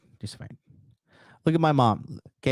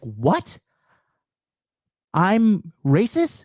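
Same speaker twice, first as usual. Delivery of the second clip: mock disbelief; 7 semitones higher, much slower than usual. Mom's disbelief retold.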